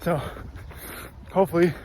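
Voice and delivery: talking mid-run, loud and breathless; speaking while jogging; while running. Real, not acted. Recording mid-run, first leg.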